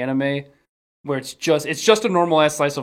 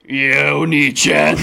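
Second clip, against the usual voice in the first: anime sister voice; 5 dB louder, slower than usual. Pretend. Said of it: "she's like".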